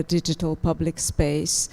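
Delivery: ordinary talk, nothing marked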